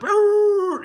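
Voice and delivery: very high, loud drawn-out squeal; screeching tyres. Retold or imitated. Sheriffs' cars pulling into the parking lot, screeching tyres. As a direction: imitate cars screeching to a halt. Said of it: "cars come screaming up".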